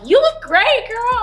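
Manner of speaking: loud, very high mock gushing; squealed, exaggerated praise; gushing compliment. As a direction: imitate excited admirers, voice way up high. Voices people paying her compliments.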